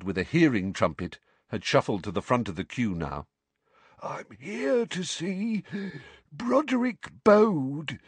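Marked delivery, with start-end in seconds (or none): 4.0-8.0 put-on slow wheezy old wizard; wheezing. A narrator's reading. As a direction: wheeze it out, short of breath. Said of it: "he wheezed".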